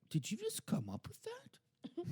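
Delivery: high, amazed mom voice; impressed mom. Retold or imitated. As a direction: squeal it like a thrilled mother. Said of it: "My mom was like".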